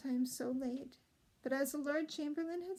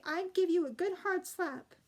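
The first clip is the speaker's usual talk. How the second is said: higher, louder mock-stern threat; severely; 6 semitones higher, 6 dB louder than usual. A narrator's reading. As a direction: scold him with pretend fury. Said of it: "said the ordinary princess severely".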